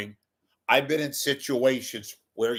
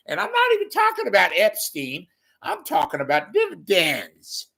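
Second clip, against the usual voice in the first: James Carville impression; 8 semitones higher, 4 dB louder than usual. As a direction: play a folksy Louisiana pundit ranting. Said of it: "this is my impression of James Carville".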